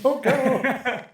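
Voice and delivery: high, laughing mock plea; mock child's scream. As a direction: playfully imitate a clinging kid crying out.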